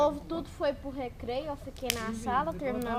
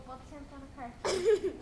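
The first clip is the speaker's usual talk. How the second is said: ordinary talk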